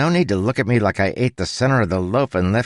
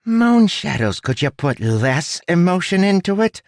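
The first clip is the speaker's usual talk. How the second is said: high-pitched rat exasperation; exasperated little rat director; sarcastic rat; 12 semitones higher, 3 dB louder than usual. A narrator's reading. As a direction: exclaim high, with heavy sarcasm. Rat sarcastic at poor acting.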